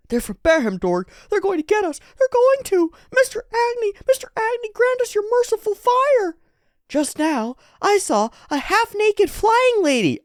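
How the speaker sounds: very high, squeaky panicked voice; frantic young believer. Acted. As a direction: squeal for help from the god. Voices frantic boy in manga.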